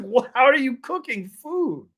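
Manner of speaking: high, loud, drawn-out astonishment; incredulous high-pitched question, stretched slowly; amazed disbelief. Retold or imitated. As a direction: re-enact being blown away, voice shooting up. Acting out amazed reaction to cooking.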